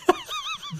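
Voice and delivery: quick high-pitched giggle; high squeaky laugh. Real, not acted. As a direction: let out a short squeal of laughter. Laughing at his own joke.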